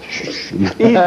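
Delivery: unvoiced shushes, then a high-pitched plea; mock panicked shushing; retold shushing. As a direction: hush him urgently, half laughing after. Shushing the loud priest in story.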